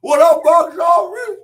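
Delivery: slow, loud hype greeting; calling out greeting. Real, not acted. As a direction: call it out big to your co-host.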